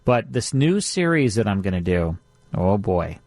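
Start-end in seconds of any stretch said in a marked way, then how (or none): none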